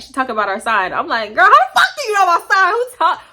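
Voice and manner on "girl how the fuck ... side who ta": high, loud, rapid outburst; indignant confrontation. Retold or imitated. Confronting friend over knowing her side, loud retold indignation. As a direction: fire it off, mock outraged.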